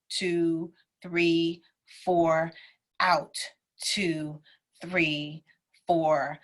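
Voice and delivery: calm, steady counting of the breath; counted breathing. Acted. Teacher counting a breath cycle for students, in a calm voice.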